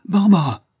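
high, loud cry of a name in terror; a frightened man crying out a woman's name; terrified cry. A narrator's reading. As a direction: call out the name in sudden fright. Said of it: "avec un accent de terreur".

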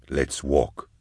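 low, soft command; captor's order. A narrator's reading. Captor shoving his hostage along, low quiet order. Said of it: "he said, shoving her along".

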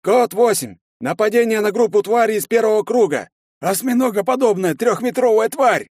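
high-pitched urgent radio shout; торопливый доклад. A narrator's reading. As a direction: shout the report to the base. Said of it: "начал торопливо докладывать". (срочно кричать доклад в рацию)

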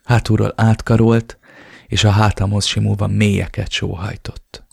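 usual speaking voice

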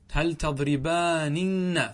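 "تضربانن" with every n sounded, long aa; slow teacher's demo of "تضربانن", ending -aaninna; original form. Shown as a demonstration. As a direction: draw out "تضربانن" as tadribaaa-ninna. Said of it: "ثلاث نونات".

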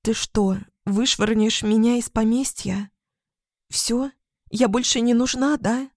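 высокий, обиженный голос феи; фея вот-вот заплачет. A narrator's reading. Фея говорит со слезами. (фея со слезами на глазах)

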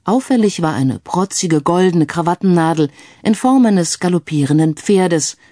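usual speaking voice

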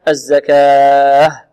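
loud الزكاة with the final aa held four beats; four-count stop. Shown as a demonstration. Teacher showing the middle pausal length, held vowel. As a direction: stretch the end of الزكاة moderately. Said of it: "وأربع حركات".

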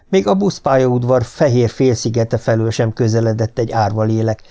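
usual speaking voice

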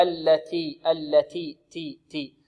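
slow "التي", breathy t with no trace of s; correct aspirated t. Shown as a demonstration. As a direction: release the t of "التي" with light air, never hissing. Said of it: "بدون رائحة السين". (تاء مهموسة في "التي" بدون رائحة السين)

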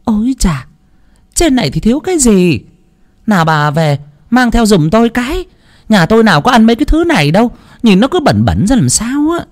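high, haughty sneer, fast; sneering mother-in-law. Acted. Mother-in-law sneering at her in-law's gifts, haughty and high. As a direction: look down your nose at her. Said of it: "hẩy hẩy ngón tay mà khèo khèo".

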